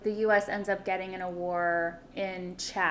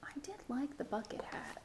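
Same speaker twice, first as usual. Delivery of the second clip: whispering; 4 semitones higher, 16 dB quieter than usual. Real, not acted. Confessing an aside.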